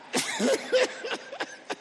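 high laugh that runs on. Real, not acted. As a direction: burst into helpless laughter. Laughs at own joke.

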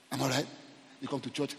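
normal talking voice

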